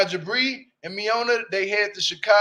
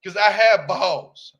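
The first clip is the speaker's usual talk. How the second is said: slow, louder, HAVE hit; emphatic; 5 dB louder, slower than usual. Real, not acted. Bragging to viewers, slow and emphatic. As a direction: plant each word slowly.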